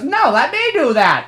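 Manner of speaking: high, insistent put-on voice; mock pushy cartoon character; bad Woody voiceover. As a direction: butt in like a hammed-up cartoon cowboy. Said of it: "really bad Woody voiceover".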